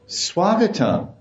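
high, slow, lightly mock-formal greeting; formal respect. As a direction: draw out a politely indifferent welcome. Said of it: "speaking words of indifference and respect".

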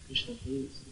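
hushed, desperate plea; barely audible tearful pleading; crying for Krishna. Shown as a demonstration. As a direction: beg for help very softly, as if crying. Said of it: "crying for Krishna".

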